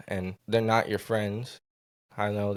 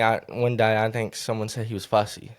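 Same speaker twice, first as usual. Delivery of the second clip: usual speaking voice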